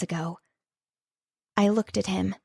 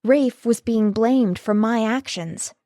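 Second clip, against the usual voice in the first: ordinary delivery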